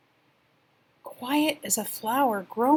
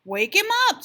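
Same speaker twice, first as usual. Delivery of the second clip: high, louder squirrel voice; 10 semitones higher, 6 dB louder than usual. Acted. Squirrel urging the rabbit, in a high voice. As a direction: chirp it like an eager squirrel. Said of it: "said the squirrel".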